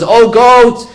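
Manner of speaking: high, loud cry for help; acted yell. Mouse runs to the goat for help, acted yell. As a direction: raise pitch and volume, urgently calling for help. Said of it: "He begins to yell".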